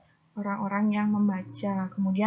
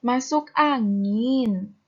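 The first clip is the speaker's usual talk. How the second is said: louder, drawn-out ANGIN as an ad question; questioning intonation; 5 dB louder, much slower than usual. Shown as a demonstration. Teacher showing an ad's question to pupils, questioning intonation. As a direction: ask it slowly, swinging the tune on "angin". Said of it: "ada intonasi tinggi di belakangnya".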